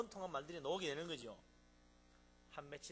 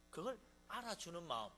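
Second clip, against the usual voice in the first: ordinary talk, nothing marked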